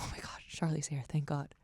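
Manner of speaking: breathy whispered relief; relieved whisper. Retold or imitated. To herself when Charlie walks in, whispering relief. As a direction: whisper it like a weight just lifted.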